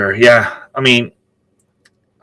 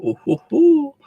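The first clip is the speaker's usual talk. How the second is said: very high "ooh, hoo, hoo"; high-pitched playful whoop; playful hooting. Real, not acted. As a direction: hoot it way up high, teasingly. Joking whoop after the t-shirt question.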